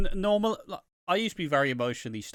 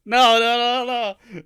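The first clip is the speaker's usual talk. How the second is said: loud, high cries of no; anguished shout; 10 semitones higher, 12 dB louder, slower than usual. Retold or imitated. Watching a beloved character die, loud shouting no. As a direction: yell at the screen as a character dies. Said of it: "I'm just like".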